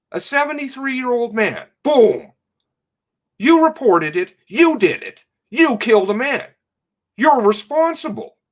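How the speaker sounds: pin the blame on her, raging; furious accusation. Real, not acted. Accusing the police chief.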